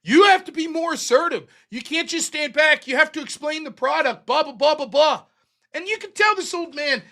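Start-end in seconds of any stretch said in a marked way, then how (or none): 0.0-5.2 higher, mock nagging boss voice; lightly hammed-up scolding boss; imitating bossy manager. Manager lecturing the old sample man, mock nagging. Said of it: "she's giving him the fucking business".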